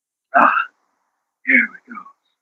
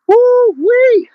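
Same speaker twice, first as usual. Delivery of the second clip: long, very high-pitched woo-wee; high whoop. Real, not acted. Exerciser celebrating the end of a burning set, high whoop. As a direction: let out a high, drawn-out whoop.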